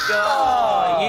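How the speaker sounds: excited shout. Real, not acted. Watching the relay fall.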